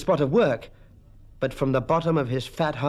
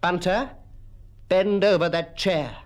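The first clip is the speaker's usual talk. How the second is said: firm command, pitched a little higher; stern schoolmaster's order; 5 semitones higher than usual. Acted. Mister Quelch picks up his cane, stern command.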